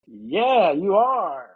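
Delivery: high, drawn-out baffled reply; mock bewildered student voice; confused kid. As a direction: imitate a freshman slowly taking in a surprise. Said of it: "looking at me a little confused".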